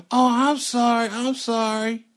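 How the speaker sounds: put-on high, loud child voice; put-on high-pitched apology; apologizing little boy. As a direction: mimic a caught kid blurting an apology. Plays the caught boy apologizing.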